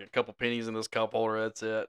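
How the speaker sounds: usual speaking voice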